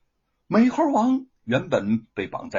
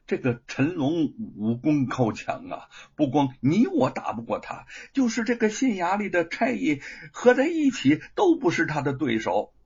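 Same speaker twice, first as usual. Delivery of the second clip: usual speaking voice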